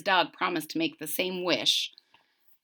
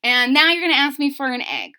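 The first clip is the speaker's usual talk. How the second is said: high, indignant bird lady; high bird voice, annoyed; giant bird scolding; 9 semitones higher than usual. Acted. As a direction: scold them as the big bird. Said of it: "she's like".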